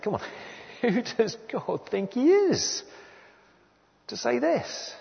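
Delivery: high-pitched, slow incredulous protest; mock indignation. Preacher voices a doubter objecting to God, mock-indignant. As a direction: scoff in high, stretched-out disbelief.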